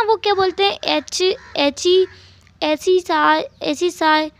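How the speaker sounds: put-on high, slow, innocent child voice; little boy's voice. Narrator voices little boy Jingi. (ऊँची, भोली बच्चे की आवाज़)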